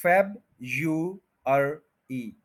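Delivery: February split slowly into FEB, YU, ER, EE; four separate beats in February, with gaps; syllable by syllable. Shown as a demonstration. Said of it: "इसका pronunciation ये बताता है". (February, अलग-अलग syllable)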